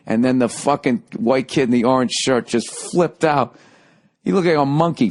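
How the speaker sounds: ordinary talk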